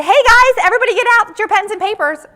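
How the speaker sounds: loud, high "hey guys" hailing the class; calling out to a room. Retold or imitated. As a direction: summon everyone's attention like a breezy instructor. Speaker acts a presenter calling.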